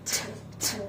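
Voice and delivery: t breathed out softly without hitting. Shown as a demonstration. Teacher showing a soft t to a pupil. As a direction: tap t lightly behind the teeth. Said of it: "It is very soft sound".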